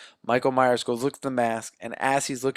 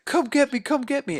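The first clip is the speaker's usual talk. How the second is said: much higher, mock-taunting girl voice; girl daring the killer; 11 semitones higher than usual. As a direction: act out a girl goading her attacker.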